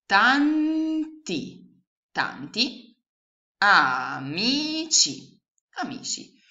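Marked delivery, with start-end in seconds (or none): 0.0-1.8 louder, higher "Tanti" split as TAN-TI; syllable by syllable. Shown as a demonstration. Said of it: "Quindi la frase che voi avete costruito". 3.6-5.4 very slow, louder "amici" as A-MI-CI; syllable by syllable. Shown as a demonstration. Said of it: "Quindi la frase che voi avete costruito".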